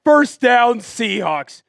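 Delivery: slow, loud sports call; live play-by-play. Acted. Streamer calling the game for viewers, play-by-play voice. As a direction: call the run like a broadcaster.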